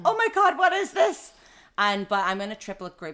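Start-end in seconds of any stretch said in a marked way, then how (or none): 0.0-1.3 much higher and louder, laughing disbelief; mock horror at feeling so sick; retold shock, laughing.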